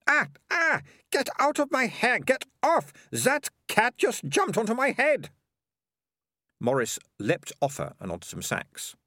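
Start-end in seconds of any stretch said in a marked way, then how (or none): 0.1-5.3 high, louder cries of alarm as the cat lands; startled yelps. Acted. Melissa yelps as the cat jumps onto her head. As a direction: shriek and swat at the cat in your hair.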